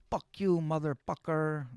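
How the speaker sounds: high mimicked Filipino accent, drawn out, Fs turned to Ps. Joking curse from his Filipino girlfriend, mimicked Filipino accent. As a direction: imitate a Filipino girl cursing, high-pitched. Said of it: "That's how she would talk".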